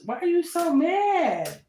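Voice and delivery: high, drawn-out mock question; mocking taunt. Teasing an angry Aries, then laughing.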